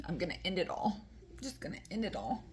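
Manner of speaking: fast, soft dramatic resolve; rushed mock despair. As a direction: blurt it like a hasty drama queen. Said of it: "she decided".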